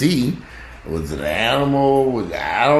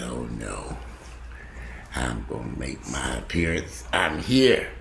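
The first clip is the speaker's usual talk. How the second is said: dog's inner voice; 8 semitones lower, 7 dB quieter, slower than usual. Pretend. Owner voices the dog's thoughts.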